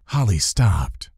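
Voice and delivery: ordinary delivery, nothing marked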